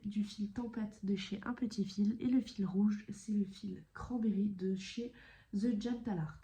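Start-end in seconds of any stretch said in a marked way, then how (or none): 5.5-6.4 English name in a heavy French accent; thick French accent. Real, not acted. Vlogger naming a thread brand, thick French accent. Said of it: "je suis désolée avec mon accent".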